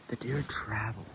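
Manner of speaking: ordinary talk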